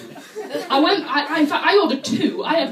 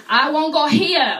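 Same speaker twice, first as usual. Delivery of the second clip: slow, louder, foreign tourist; broken English; 3 semitones higher, 6 dB louder, slower than usual. Retold or imitated. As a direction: tourist insisting in halting English. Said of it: "and she goes".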